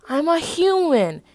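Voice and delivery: high, slow, loud shout. Acted. Peter shouting that he is only human. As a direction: yell it in protest. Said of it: "he shouts".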